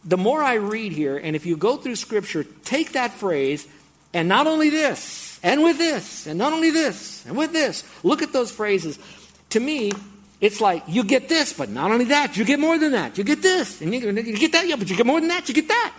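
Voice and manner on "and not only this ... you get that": high, loud, mounting excitement; excitedly piling one point on another; pretty excited. Real, not acted. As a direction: get carried away, pitch jumping up on each phrase. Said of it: "I know I'm pretty excited".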